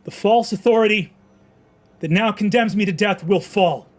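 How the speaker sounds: high, loud speech of defiance; defiant proclamation. Acted. Condemned man addressing the crowd, loud and defiant. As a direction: hurl the last words at the crowd.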